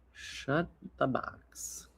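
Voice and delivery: whispered to self. Real, not acted. Repeating the game's name under breath, whispered. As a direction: mouth the name to yourself.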